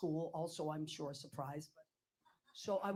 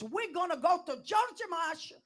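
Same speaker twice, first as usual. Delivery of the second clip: much higher, louder, slow mother voice; mother's voice; 11 semitones higher, 9 dB louder, slower than usual. Retold or imitated. Wrong take, voicing her mother by mistake. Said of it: "that's my mother's voice".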